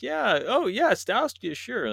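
high, fake-eager agreeing; mock forced enthusiasm. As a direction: pretend to be thrilled to keep your job. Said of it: "they're like".

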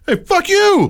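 high, indignant retort; high indignant yell. Retold or imitated. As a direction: fire it back, high and hot. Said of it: "I'm like".

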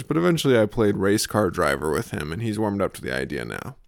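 normal talking voice

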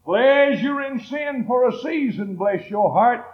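slow, high, ringing preacher shout; full-force preaching. Acted. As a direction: hammer the message home to the congregation.

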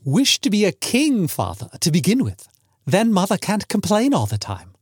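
put-on playful, high-pitched young son; smiling teasing. Son urging his father to wish, smiling and playful. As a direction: tease with a smile, pitch swooping up. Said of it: "Smiling, he said".

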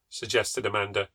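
usual speaking voice

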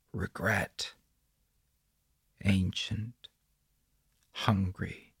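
soft, separate hushed words; whispered. A narrator's reading. Doug repeats the chanted words to his phone, hushed. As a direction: murmur each word on its own, softly. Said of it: "With each whispered word".

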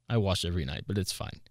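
ordinary talk

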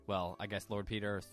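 normal talking voice

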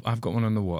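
normal talking voice

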